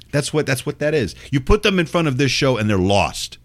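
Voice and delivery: ordinary talk, nothing marked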